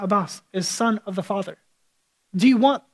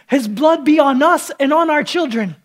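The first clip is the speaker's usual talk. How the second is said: louder, higher mock crowd yell; crowd scream; 6 semitones higher, 7 dB louder than usual. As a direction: shout it like the mob. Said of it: "And the crowd scream".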